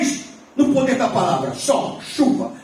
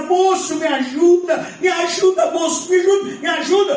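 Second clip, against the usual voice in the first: higher-pitched pleading woman; imitated female voice; 5 semitones higher than usual. Woman calls a stranger for help at night, urgent pleading. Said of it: "ele ouviu uma voz feminina".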